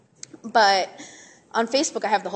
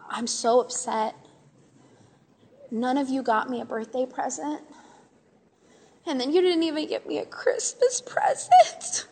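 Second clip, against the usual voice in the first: mock whining; 3 semitones higher than usual. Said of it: "I just got all dramatic on them".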